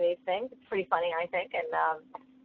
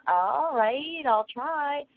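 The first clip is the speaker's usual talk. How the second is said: drawn-out, higher-pitched grudging agreement; reluctant kid; 6 semitones higher, much slower than usual. Retold or imitated. Kids asked to try meditation, reluctantly agreeing. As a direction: mimic a child giving in half-heartedly, pitch swooping up.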